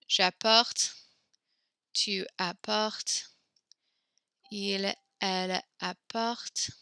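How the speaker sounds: spelled out slowly for the class; "tu apportes" said at half speed; slow dictation. Shown as a demonstration. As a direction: give them time to write it down. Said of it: "teacher dictates conjugation slowly".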